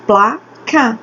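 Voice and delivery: PLA, pause, CA; syllable by syllable. Shown as a demonstration. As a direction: split placa into two clear beats. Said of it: "Eu abri a boca duas vezes pra falar ela".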